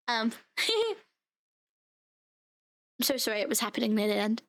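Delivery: ordinary delivery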